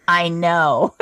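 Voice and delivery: low, emphatic imitation of the mentor; knowing mentor. Mentor replying to her steak confession, knowing mentor. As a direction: answer low and certain, already knowing.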